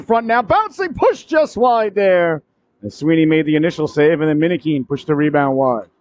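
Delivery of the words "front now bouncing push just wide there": high-pitched burst as the chance appears; excited near-miss call. Real, not acted. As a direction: stretch out the near miss, thrilled.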